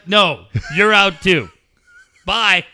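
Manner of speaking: loud, drawn-out mock firing; harsh dismissal. Gamer acting out sacking the assistant coach, loud and harsh. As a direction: boot them out with brutal finality.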